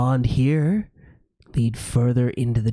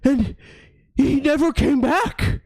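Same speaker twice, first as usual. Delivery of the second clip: sad dog voice; 12 semitones higher, 3 dB louder than usual. Acted. GM voices the lost hound.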